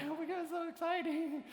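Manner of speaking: hammed-up giddy gush; mock excitement. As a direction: squeal it like a starstruck newbie. Gushing freshman on the steps.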